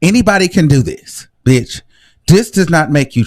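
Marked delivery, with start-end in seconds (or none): none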